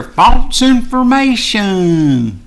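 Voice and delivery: False information dragged out very slowly, high and loud with a falling end; scornful repeat. Real, not acted. Mocking the censors, a very slow, high, loud falling sneer.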